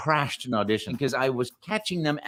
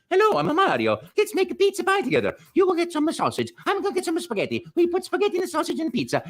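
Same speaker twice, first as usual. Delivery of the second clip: put-on high, fast, loud Italian-accented chatter; improvised Mario voice; 3 dB louder, faster than usual. Retelling the audition improv for the director, high fast Mario. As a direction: rattle off a pizza plan as an excited plumber. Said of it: "I go".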